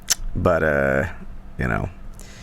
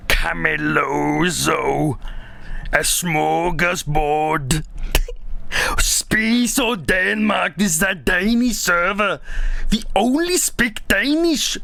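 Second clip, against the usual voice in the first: slow, much higher, hammed-up Danish accent; mock Danish accent; 6 semitones higher, much slower than usual. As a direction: drawl it out like a parody Dane. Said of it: "Tell a Danish joke".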